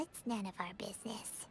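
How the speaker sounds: soft, whispered shrug; whispered aside. Acted. Visitor whispering to a companion, soft whisper. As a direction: drop to a whisper and let it go. Aside about the jumpy prop maker.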